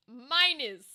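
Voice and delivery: usual speaking voice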